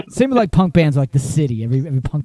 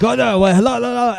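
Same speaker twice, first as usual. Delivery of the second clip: higher, flustered imitation; confused store owner; 7 semitones higher than usual. Caller imitating the bewildered store owner, flustered. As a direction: mimic baffled stammering hellos on the phone. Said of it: "he was just very confused".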